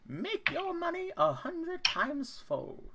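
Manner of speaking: very high, showman gnome; a carnival barker gnome, slow and tempting; gnome merchant voice. Acted. Gnome invites audience.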